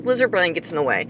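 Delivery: normal talking voice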